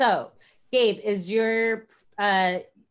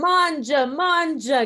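mock Italian accent; 6 semitones higher, 4 dB louder, slower than usual. Joking along in mock Italian.

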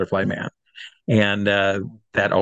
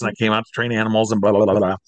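ordinary delivery

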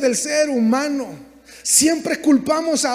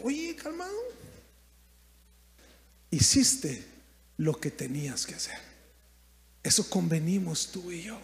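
a hint of a patient God; calm voice of God; 6 semitones lower, 10 dB quieter than usual. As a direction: settle him down gently. God answers calmly.

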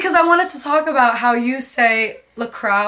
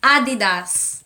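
slow demonstration in a Filipino accent; 3 dB louder, much slower than usual. Shown as a demonstration. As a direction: draw out Adidas with a Filipino accent. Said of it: "saying that in a Filipino accent".

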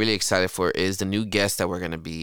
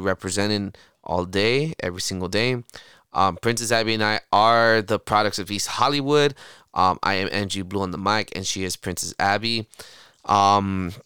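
normal talking voice

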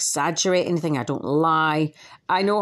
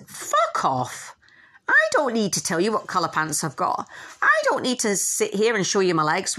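dismissive retort; 3 semitones higher than usual. Retold or imitated. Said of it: "come out of the emotional attack and go".

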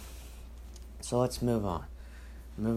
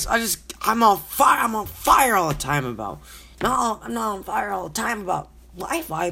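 loud, high-pitched fed-up outburst; angry rant; 6 semitones higher, 6 dB louder than usual. Real, not acted. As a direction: rant heatedly, hammering the complaint. Acting out last night's angry rant.

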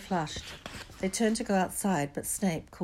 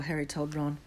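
ordinary delivery

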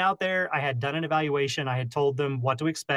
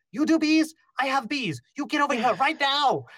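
screaming neighbor lady; 11 semitones higher than usual. Retold or imitated. Neighbor lady shrieking at the beekeeper, high and frantic. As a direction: squeal it like a panicked neighbor. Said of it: "starts screaming at me".

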